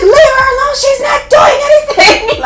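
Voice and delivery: roommate screaming at the nurses, hammed up; mock shouting. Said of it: "She was like".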